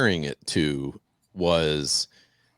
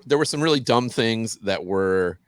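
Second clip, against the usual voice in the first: ordinary talk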